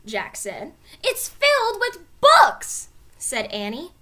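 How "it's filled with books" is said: slow, high, loud delight; excited. A narrator's reading. Annie calling down to Jack about the books, excited. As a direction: savour the news.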